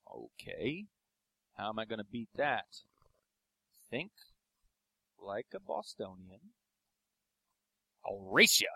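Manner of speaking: low, soft mock statesman musing; Kennedy. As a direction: think aloud slowly, pitched low and soft. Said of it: "Kennedy came out and he was just like".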